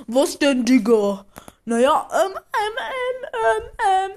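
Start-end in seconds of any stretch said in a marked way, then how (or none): 2.4-4.1 mock whimper. Friend stalls on the phone.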